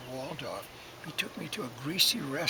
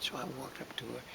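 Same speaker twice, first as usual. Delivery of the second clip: usual speaking voice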